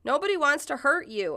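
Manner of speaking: high, retold yell. Woman protester to gun-holder, yelling. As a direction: call out to reassure from a distance. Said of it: "another woman yells".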